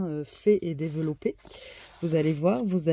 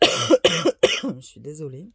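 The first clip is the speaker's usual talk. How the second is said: hoarse voice; 7 dB louder, much slower than usual. Real, not acted. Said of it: "avec ma voix cassée et mon nez bouché".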